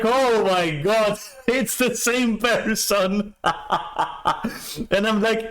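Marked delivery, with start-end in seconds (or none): 0.0-3.4 slow, high, laughing through the words; laughing disbelief. Retold or imitated. Realizing the creep is the same man, laughing and high. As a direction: stretch it out, cracking up in disbelief.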